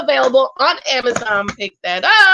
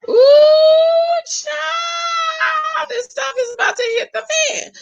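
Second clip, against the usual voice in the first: excited shriek; much slower than usual. Real, not acted. Vlogger squealing to viewers that the drama is about to start. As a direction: shriek it out like juicy gossip finally arrived.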